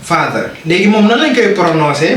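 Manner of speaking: ordinary delivery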